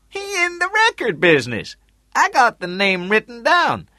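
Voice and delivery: high, loud character voice; Tootie reporting back. A narrator's reading. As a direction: report it loudly, pitched well above your own.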